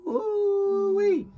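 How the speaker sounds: high, drawn-out mock whoop; long high-pitched warning whoop; playful falling-rider exclamation; exclaimed "ooh-wee". Mimes hitting a pothole.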